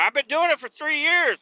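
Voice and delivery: high-pitched mock protest; defensive coworker. Worker answers criticism of his skills, high defensive protest. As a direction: imitate an indignant worker insisting on experience.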